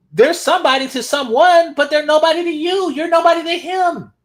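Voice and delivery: high, loud mock indignation; mocking outburst. Reactor mimicking who counts as somebody to whom, mock indignant outburst. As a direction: push it high and loud, held up on one note. Mocking a woman's heated claim.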